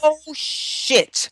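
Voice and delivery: sudden high yelp of dismay, acted out; mock dismayed curse. Therapist acting out breaking something, high dismayed blurt. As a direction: blurt it out pitched high like you just broke something. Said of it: "you would probably should use some expletives like".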